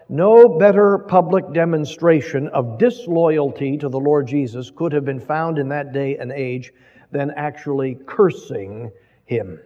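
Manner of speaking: ordinary talk, nothing marked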